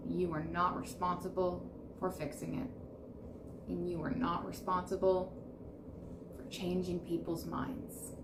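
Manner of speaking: guided breathwork. Acted. Leads breath work.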